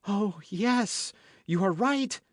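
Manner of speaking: high-pitched frightened woman's voice; affrighted cry. A narrator's reading. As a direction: cry it out in alarm, voice leaping up. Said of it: "cried the affrighted Madame Bonacieux".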